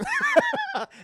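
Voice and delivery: high burst of laughter; real laughter. Real, not acted. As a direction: crack up at your own joke. Laughs at own joke.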